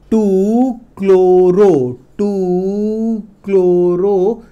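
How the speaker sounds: very slow, each syllable stretched out; slow dictation. Shown as a demonstration. Teacher spelling out a compound name for students, slow dictation. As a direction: draw out the name as if writing along.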